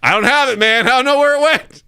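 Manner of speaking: high-pitched imitation of protesting innocence; mock innocent denial. Roommate denying he took the ladder, mock innocent denial. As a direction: play the roommate denying everything.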